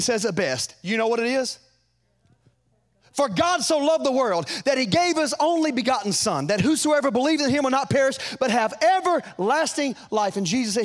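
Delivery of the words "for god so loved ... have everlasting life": higher, louder scripture proclamation; preaching at full force. Acted. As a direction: proclaim the verse, slowing at the end. Quoting John 3:16 in full voice.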